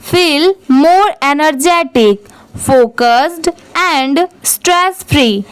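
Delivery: very slow, pausing at every comma and full stop; slow classroom read-aloud of an English paragraph; slow careful reading. Shown as a demonstration. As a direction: read it out word by word for learners. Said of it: "वहाँ पर थोड़ा सा रुकिए".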